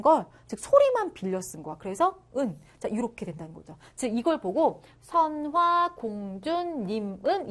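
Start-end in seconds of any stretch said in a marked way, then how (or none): 5.0-7.5 선화공주님은 stretched slowly, syllable by syllable; drawn-out reading. Shown as a demonstration. Teacher shows how it was read, 선화공주님은 slowly drawn out. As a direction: draw out each syllable of 선화공주님은. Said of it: "이렇게 읽었다라는 거죠".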